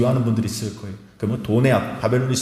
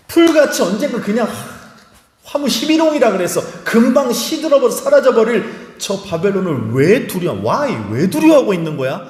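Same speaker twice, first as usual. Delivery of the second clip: incredulous rebuke; 8 semitones higher, 3 dB louder than usual. Shown as a demonstration. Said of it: "이 뉘앙스가 뭐냐면 이거예요".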